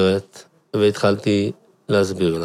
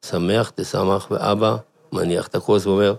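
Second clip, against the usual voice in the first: ordinary talk, nothing marked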